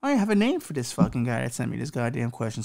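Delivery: ordinary delivery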